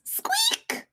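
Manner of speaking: very high rising squeak; a mouse's squeak. Acted. Squeaky noise in story.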